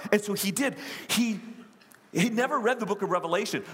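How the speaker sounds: normal talking voice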